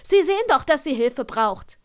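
sharp, high, loud rebuke; wütend und laut; angry retort. A narrator's reading. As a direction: snap back at him in anger. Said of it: "einem verärgerten Blick".

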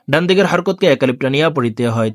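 normal talking voice

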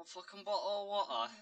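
put-on British "bottle of water"; mock British accent. Shown as a demonstration. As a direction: say it like a British lad. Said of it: "he says you can do British accent".

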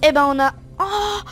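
loud, rising joy at the win; excited victory cry. Real, not acted. Gamer cheering to viewers after winning the match, loud and excited. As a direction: shout out the win, real and thrilled.

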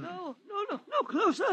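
high frightened pleading; panicked old man. Acted. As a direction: back away in terror, voice climbing. Witness panicking at gun.

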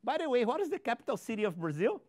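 usual speaking voice

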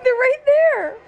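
high, fast whiny dog voice; high pleading pup voice; voicing the dog. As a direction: speak for the greedy dog. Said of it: "She's like".

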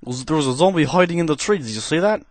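cheesy put-on Russian accent held through gameplay chat; deliberately hammy Russian accent, never dropping it. Gamer commentating to viewers in a cheesy Russian accent. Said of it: "trying to perform a Russian accent".

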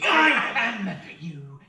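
loud, slow, warped gloating laugh through the words; a demon revelling in its hold; demonic laugh. Acted. Inner demon to Kane, mocking laughing roar.

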